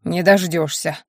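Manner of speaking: ordinary delivery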